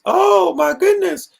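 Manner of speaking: high, slow, drawn-out fake gasp of shock; mock horror pitched very high; mock shocked public. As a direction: play the clutching-pearls crowd, way up high. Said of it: "The reaction was".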